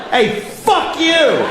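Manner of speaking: high, slow mock curse; hammed-up yell at a stranger; mock shout in an elevator. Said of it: "turn right to their face and go".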